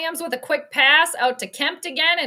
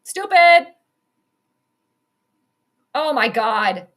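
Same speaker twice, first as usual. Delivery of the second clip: much louder, disgusted stupid; 7 dB louder, slower than usual. Real, not acted. Fan yelling at the Bears, loud disgust. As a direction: blurt it at the screen. Fan reacting to bad call.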